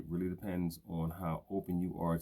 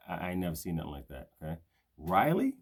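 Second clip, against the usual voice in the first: ordinary delivery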